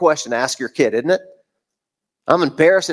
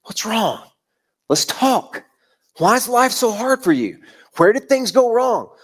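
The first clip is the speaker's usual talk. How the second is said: tender stooping voice; 4 semitones higher than usual. Acted. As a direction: speak like a nurse to a child. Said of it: "He speaks with a lisp".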